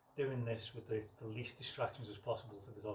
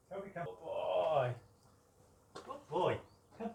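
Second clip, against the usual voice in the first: high, louder call and praise; excited recall and praise; 7 semitones higher, 4 dB louder, slower than usual. Real, not acted.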